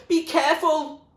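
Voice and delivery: high, loud yell from afar. Acted. Zoe yells to William from the tower, loud. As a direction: call across the battlefield. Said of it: "yelled Zoe from the tower".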